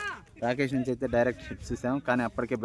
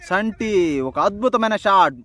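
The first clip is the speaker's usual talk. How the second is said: excited; 9 semitones higher, 7 dB louder, slower than usual. Real, not acted. Big shot in commentary.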